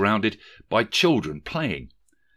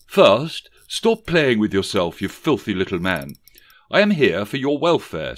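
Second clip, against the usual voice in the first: stern Thatcher scold; 2 semitones higher, 7 dB louder than usual. A narrator's reading. The ghost scolds Rishi for his filthy habits, loud and stern. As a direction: bark at him like a headmistress.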